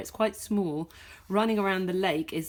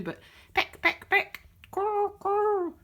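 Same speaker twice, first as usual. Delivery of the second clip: pigeon pecks and caws; 12 semitones higher than usual. Acted. Pigeons attacking in the story.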